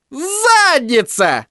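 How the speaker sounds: высокий мальчишеский выкрик, протяжно; смакуя обидное слово; Тедди дразнит. Acted. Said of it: "с непонятным сладострастием повторил Тедди". (Тедди с наслаждением дразнит Майло)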